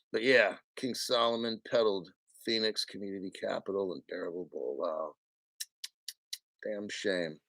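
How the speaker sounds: quiet disgusted mumble; low muttered aside. Real, not acted. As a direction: mutter it under your breath, shaking your head. Muttering the accusation again, aside.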